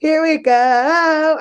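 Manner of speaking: slow, high, eerie singsong; horror movie version. Shown as a demonstration. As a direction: drag it out like a horror film. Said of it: "the horror movie version of it".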